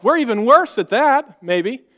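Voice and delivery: normal talking voice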